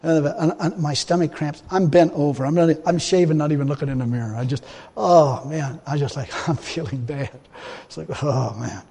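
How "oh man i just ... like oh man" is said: low, soft acted groans; mock sick groaning. Preacher reliving shaving with cramps, acted weak groaning. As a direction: groan weakly as if doubled over. Acting out sickness while shaving.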